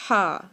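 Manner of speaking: ہا from mid-throat, strong breathy push; Arabic haa. Shown as a demonstration. Teacher repeats throat ہا for students. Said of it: "وسطی حلق سے ہم اس کو ادا کریں گے".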